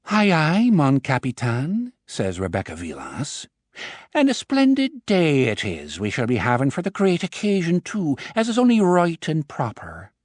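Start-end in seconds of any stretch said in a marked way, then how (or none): 0.1-1.9 put-on playful stage Irish accent, higher; a jaunty hint of stage Irish; teasing boss in stage Irish; mock Irish. A narrator's reading. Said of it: "Her Irish accent is surprisingly good". 4.1-10.1 high, lilting stage Irish brogue; secretary teasing boss in stage Irish accent. A narrator's reading. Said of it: "Her Irish accent is surprisingly good".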